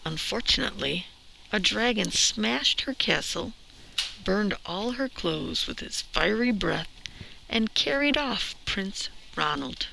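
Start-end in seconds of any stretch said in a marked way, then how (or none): none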